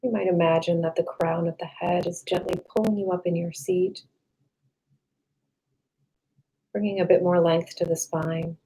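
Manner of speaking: calm meditation voice with long, restful pauses; guided meditation. Real, not acted. Meditation guide leading listeners to relax the body, calm and unhurried.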